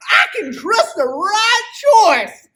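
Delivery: high, loud, slow cowboy shout. Acted. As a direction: belt it like a rodeo cowboy. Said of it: "you got the best cowboy voice".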